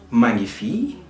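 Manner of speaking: ordinary talk, nothing marked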